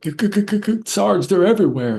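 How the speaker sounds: high, frantic stammering soldier voice; terrified soldier stuttering to his sergeant; panicked stutter. Retold or imitated. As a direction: stammer it out in terror, voice jumping up. Panicked soldier calling to sarge.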